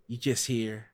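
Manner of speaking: ordinary talk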